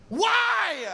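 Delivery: high, loud cry of mock despair; outraged why. Real, not acted. Comedian sees his own photo, loud high mock wail.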